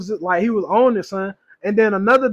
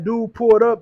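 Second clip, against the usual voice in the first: ordinary talk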